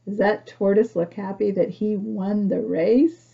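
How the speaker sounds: ordinary delivery, nothing marked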